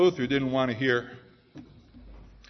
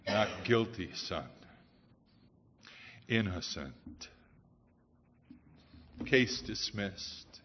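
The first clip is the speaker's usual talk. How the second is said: much softer and lower, slow and tender; a father-judge quietly setting his son free; the judge softly telling Martin he is innocent; gentle verdict; 7 semitones lower, 11 dB quieter, slower than usual. Acted.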